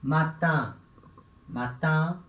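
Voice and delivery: "matin" said with a French nasal ending; careful French demo of "matin"; French pronunciation. Shown as a demonstration. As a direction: model "matin" as French "ma-tan". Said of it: "Por exemplo".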